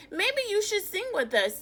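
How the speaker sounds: sing-song invitation like little birds; chirpy birds. Acted. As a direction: birds inviting the chimp, high and quick.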